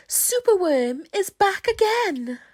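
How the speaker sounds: triumphant announcement. A narrator's reading. Hero returns triumphantly.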